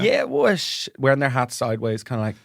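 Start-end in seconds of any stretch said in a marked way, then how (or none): 0.0-0.9 slurred, put-on English lad speech; mock a private-school English lad acting cool; posh English boy faking street accent. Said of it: "a lot of English boys".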